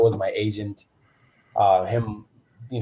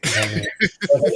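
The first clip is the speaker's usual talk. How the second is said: ordinary talk, nothing marked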